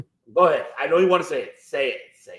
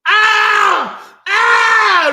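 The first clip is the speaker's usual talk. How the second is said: long, high, loud wail; mock screaming; 10 dB louder than usual. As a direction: scream it out like an over-the-top commentator. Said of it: "He started screaming".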